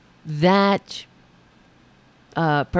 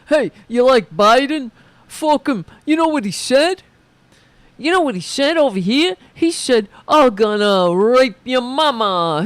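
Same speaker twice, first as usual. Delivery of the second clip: louder Bensonhurst tough-guy accent, imitating the truck driver; 3 semitones higher, 5 dB louder than usual. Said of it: "I don't know why I'm talking like that".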